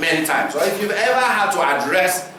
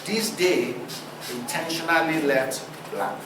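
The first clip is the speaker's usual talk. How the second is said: quiet sign reading; 3 semitones lower, 6 dB quieter than usual. Retold or imitated. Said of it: "It's like saying".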